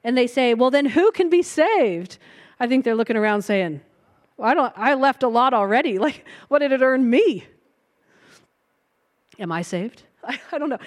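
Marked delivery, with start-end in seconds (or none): 0.5-2.2 high, loud astonished cry; alarmed disciples. Retold or imitated. Disciples panicking over who can be saved, loud high alarm. 4.4-10.0 nervous. Retold or imitated. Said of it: "they're a little nervous".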